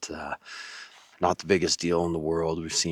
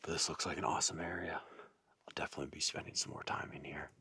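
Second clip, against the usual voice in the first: wildlife watching whisper; 13 dB quieter than usual. Real, not acted. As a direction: keep whispering to stay hidden. Whispered narration while stalking deer.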